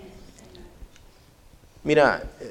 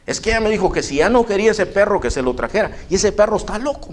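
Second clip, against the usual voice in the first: lightly higher, complaining visitor; a hint of a fed-up stranger; man voiced higher; 8 semitones higher than usual. Visitor returning the dog complains, a bit higher.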